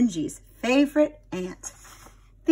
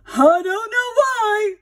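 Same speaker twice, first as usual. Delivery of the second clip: puzzled shopkeeper; 12 semitones higher, 8 dB louder, slower than usual. Acted. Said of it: "said Mister Jones".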